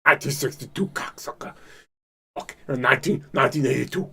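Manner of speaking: hoarse whispered imitation of Joey Diaz; Joey Diaz impression. Imitating comedian Joey Diaz's story, whispered rasp. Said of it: "Joey Diaz telling the story".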